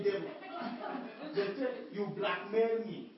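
ordinary delivery

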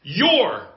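"Your" alone, loud and high with a falling tune; emphatic, drawn-out "Your"; stress on Your. Shown as a demonstration. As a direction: isolate and punch "Your". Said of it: "I want to emphasize the word".

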